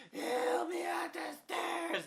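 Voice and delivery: high, slow screamed plea; loud, drawn-out falsetto demand; mock freaking out. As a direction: shriek it as the helpless neighbor, hammed-up. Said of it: "Like, freaking out".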